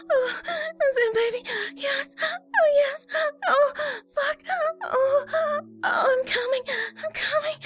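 very high, crying moans; high, overwhelmed pleasure; moaning climax. Acted. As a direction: build urgently through high-pitched cries. Acted intimate scene, building climax.

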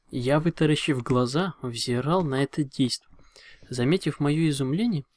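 plain reading voice

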